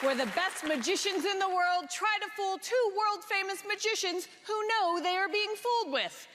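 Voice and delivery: booming prize announcer. Acted. TV show host introduces contest and prize.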